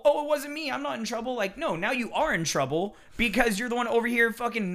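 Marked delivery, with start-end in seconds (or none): 0.0-1.3 much higher, louder nasal-free mock plea; mocking whine. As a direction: parody the excuse-maker, pitched way up. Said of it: "being the whole".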